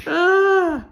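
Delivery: high, loud groan of regret; exasperated groan. Real, not acted. Vlogger recalling the botched dog haircut, groaning. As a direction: cry out at your own blunder.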